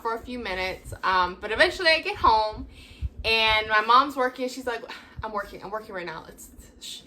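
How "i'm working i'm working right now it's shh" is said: fast hushed brush-off fading to a shh; shushing hurried mom. Retold or imitated. Mom on a work call, quick hushed shushing. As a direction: rush the words then hush.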